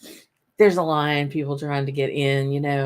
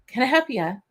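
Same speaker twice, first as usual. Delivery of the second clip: higher-pitched mock Scottish lilt; bastardized Scottish accent; 7 semitones higher than usual. Security guard offering help at the barricade, bastardized Scottish accent. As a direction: imitate a helpful Scottish guard, roughly. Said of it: "the bastardized Scottish accent".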